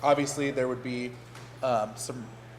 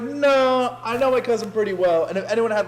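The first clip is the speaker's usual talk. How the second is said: high, loud, insistent protest; emphatic cousin voice; 8 semitones higher, 6 dB louder than usual. Retold or imitated. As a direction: push it up like a defensive cousin. Cousin defending him, voice raised.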